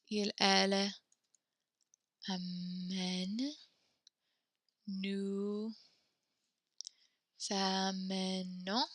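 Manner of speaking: "amenons" drawn out at half speed; "Nous... amenons" said gently and slowly; slow soft dictation. Shown as a demonstration. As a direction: spell it out slowly for the class. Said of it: "dictating slowly".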